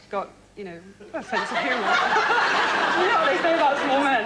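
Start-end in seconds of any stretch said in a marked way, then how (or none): 2.0-2.9 high, loud giggle; burst of laughter. Real, not acted. Contestant cracks up over small men in a high, loud laugh. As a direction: let a big laugh burst out.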